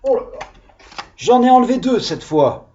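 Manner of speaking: high-pitched, teasing exclamation; playful mock alarm. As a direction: tease the children with a harder challenge. Teasing children in guessing game.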